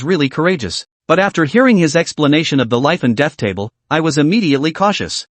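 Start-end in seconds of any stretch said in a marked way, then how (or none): none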